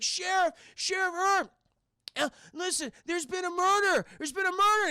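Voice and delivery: high, loud frantic report; panicked phone call. Retold or imitated. Chad calls Sheriff Herb to report a murder, frantic.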